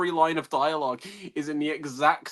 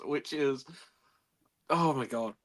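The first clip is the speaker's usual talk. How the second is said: ordinary delivery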